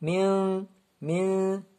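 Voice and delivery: "Min" sustained through the nose; nasal ghunna. Shown as a demonstration. Teacher shows where runna comes from, nasal "min". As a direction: let the end of "Min" hum in the nose. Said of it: "le runna sort de, du nez".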